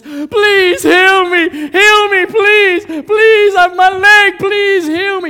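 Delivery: high, screamed plea for healing; acted frantic wailing; desperate begging. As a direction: shout and beg, stretching the words. Acts a sick person begging in street.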